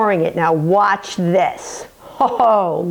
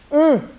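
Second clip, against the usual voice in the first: pleased hum; 6 semitones higher than usual. Real, not acted. Tasting the tart.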